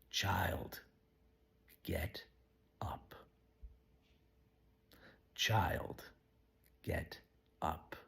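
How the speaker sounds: soft, whispered, slow words of Jesus; whispered command. Acted. Jesus to the dead girl, softly whispered. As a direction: breathe it tenderly over her.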